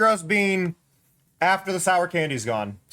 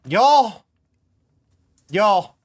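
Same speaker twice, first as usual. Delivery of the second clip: calling out to chat; 7 dB louder than usual. Real, not acted.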